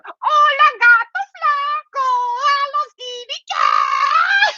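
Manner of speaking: very high, drawn-out squeal; sister mouse taunt. Acted. Sister mouse copies her brother teasing the cat, high squealed taunt. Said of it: "and she goes".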